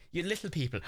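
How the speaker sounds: ordinary talk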